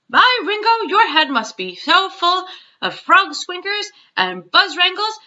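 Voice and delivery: rising indignation, voice climbing high; excited giant. Acted. Giant exclaims, exasperated.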